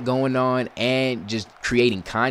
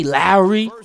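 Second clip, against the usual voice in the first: loud, high call of the name; frustrated yell; 8 semitones higher, 11 dB louder, much slower than usual. Real, not acted. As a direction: call him out in disgust. Yelling at player.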